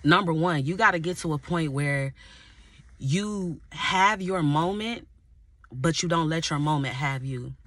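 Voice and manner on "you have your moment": slow emphasis. Acted. Preacher stressing the key phrase.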